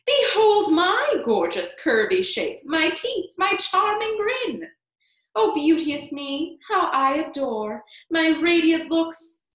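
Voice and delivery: high, loud, slow self-admiring rhyme; gloating aunt in high declamation; vain Aunt Spiker. A narrator's reading. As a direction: preen aloud, stretching out the boasts.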